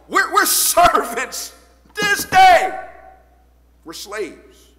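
high, loud slow wail; mock anguished cry. The captives crying out their bondage, loud.

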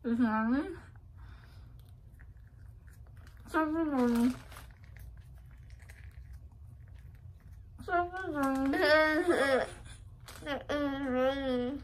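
muffled, cheeks crammed full; mouth full. Real, not acted. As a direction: force words past a full mouth. Mouth stuffed, struggling.

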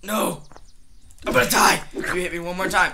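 high, loud frantic screams; terrified horror victim. Pretend. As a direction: scream as if about to die. Screaming under attack.

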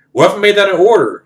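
high, pressing officer voice; challenging general. Retold or imitated. General turns a request into an order, challenging. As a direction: imitate a superior pulling rank, high, quick and loud.

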